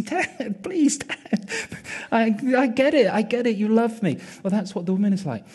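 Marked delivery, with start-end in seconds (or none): 0.0-4.2 high, half-laughing plea; protesting son. Retold or imitated. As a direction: play an embarrassed son begging Dad to stop. Son protests at father's endless kisses.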